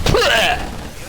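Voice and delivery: loud, high sputtered spit; explosive imitated raspberry-like spit; spitting noise. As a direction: demonstrate spitting something out. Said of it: "I went".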